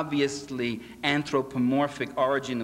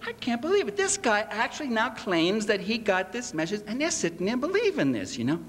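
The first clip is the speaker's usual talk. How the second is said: disbelief; 7 semitones higher than usual. Retold or imitated. Retelling his own disbelief.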